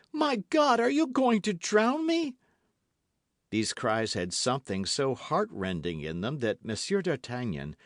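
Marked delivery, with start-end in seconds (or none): 0.1-2.4 high, loud terrified scream; terrified cry. A narrator's reading. Milady crying out as she is carried to the boat. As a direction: shriek in panic. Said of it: "cried she".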